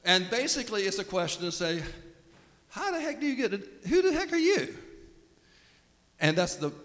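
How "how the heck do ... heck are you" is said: high, incredulous who-the-heck; mock outraged demand; mock indignant challenge. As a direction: squeak it out in disbelief. Priests challenging Jesus, mock outrage.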